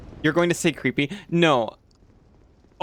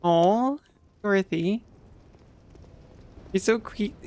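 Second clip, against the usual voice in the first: cute voice; 6 semitones higher, slower than usual. Pretend. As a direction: coo it sweetly, a bit forced. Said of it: "I'll learn how to fake it better".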